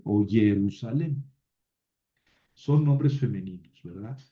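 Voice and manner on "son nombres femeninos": normal talking voice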